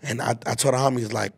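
normal talking voice

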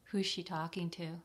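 usual speaking voice

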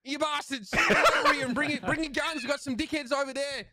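high, laughing broad Australian bogan calling his squad; rally the team like a rough Aussie bogan, chuckling; Bogan Australian accent. Pretend. Asked by co-host for a Skye voice line, high bogan Australian accent. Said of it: "like, the Bogan accent?".

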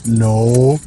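very low put-on dad voice; deep daddy voice. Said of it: "I am sounding like a daddy".

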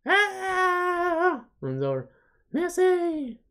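very high squeal of her name; a high fan scream; excited fan shriek. Acted. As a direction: squeal like a starstruck kid. Fan spots the star.

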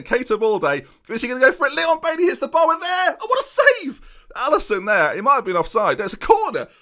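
shouting over a near miss and great save; excited commentary. Real, not acted. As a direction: call the chance like a commentator.

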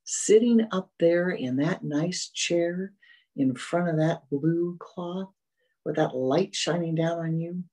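ordinary talk

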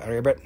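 low croaking ribbit, imitating a frog. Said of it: "Sapo means a frog".

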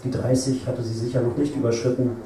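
usual speaking voice

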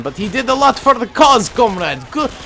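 hammed-up Russian comrade cheer, high and loud; put-on Russian accent. Gamer playfully cheers on the Russian gunner, put-on Russian accent. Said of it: "trying to say my accent's terrible".

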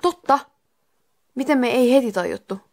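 high, loud burst of realisation; exclamation. Acted. Santeri exclaims to friends about what they missed. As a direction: cry out as it suddenly dawns on you. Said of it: "Santeri huudahti".

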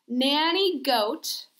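usual speaking voice

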